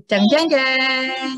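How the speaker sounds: slow, drawn-out "jeng, jeng, jeng" like a film sting; dramatic suspense sting. Pretend. Storyteller to children before the spear is thrown, a slow dramatic sting. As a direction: sing it like suspense music. Suspense sound effect for kids.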